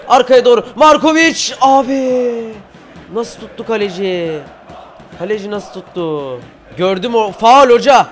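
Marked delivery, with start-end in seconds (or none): 0.1-2.5 high, loud build to the striker's name; excited shout. Real, not acted. Chance on goal, then saved. 7.3-8.1 loud, raised appeal to the referee; protesting shout. Real, not acted.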